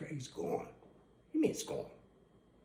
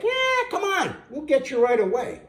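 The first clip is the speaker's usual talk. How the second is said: eager dog voice; 6 semitones higher, 3 dB louder than usual. Pretend. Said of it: "They're up there like".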